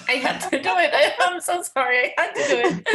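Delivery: high, laughing, sheepish confession; laughing apology. Real, not acted. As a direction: giggle helplessly while saying sorry. Giggly apology for interrupting with find.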